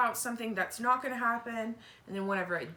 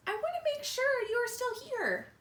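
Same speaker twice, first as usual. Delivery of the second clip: put-on much higher little girl voice; child voice; 14 semitones higher than usual. Wimberly checking on her mother, higher childlike voice. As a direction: voice a small worried child.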